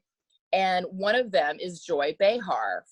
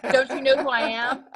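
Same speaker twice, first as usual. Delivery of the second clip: mock diva; 5 semitones higher than usual. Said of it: "No, I'm an actor".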